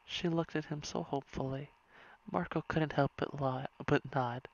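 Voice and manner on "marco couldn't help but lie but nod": ordinary delivery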